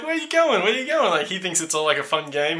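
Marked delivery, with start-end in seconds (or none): 0.0-1.1 playful calling. Retold or imitated. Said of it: "He's like".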